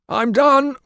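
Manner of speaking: loud, high call through the door. Acted. Vision calling out to the guards, loud.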